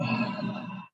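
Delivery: long audible exhale; deep breath out. Shown as a demonstration. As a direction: let a deep breath all the way out. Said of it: "Deep breath out".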